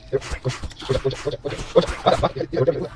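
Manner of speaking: whispered swearing in panic; cursing under breath. Real, not acted. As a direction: hiss the curses under your breath. Cursing while cornered.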